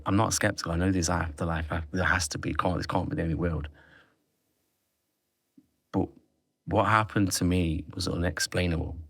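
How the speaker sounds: ordinary talk, nothing marked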